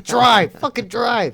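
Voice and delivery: high, loud panicked yell; frantic shout. Retold or imitated. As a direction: yell at him to floor it. Buddy yells at driver to flee.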